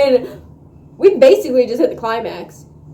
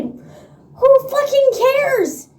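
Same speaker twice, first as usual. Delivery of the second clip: much higher indignant yelling; heated rant; 12 semitones higher, slower than usual. Real, not acted. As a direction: shout it like nobody should care. Heated rant about the book.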